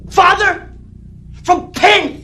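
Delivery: high-pitched, loud angry questioning; enraged, very high shouting; furious yelling. Acted. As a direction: hurl each word as an accusation. Enraged confrontation in the quarrel.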